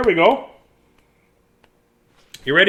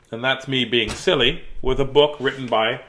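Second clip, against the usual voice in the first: ordinary delivery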